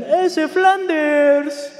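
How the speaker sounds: high, drawn-out jeering call; slow, high-pitched holler; retold shout. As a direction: yell it across, stretching the syllables. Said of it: "me gritan".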